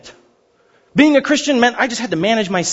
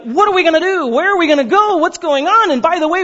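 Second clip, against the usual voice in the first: high-pitched, mock-frantic questions; retold bewildered grumbling, pitched up; imitated panicky complaining; 8 semitones higher than usual. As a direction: complain like a crowd going crazy. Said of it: "they start complaining and going crazy".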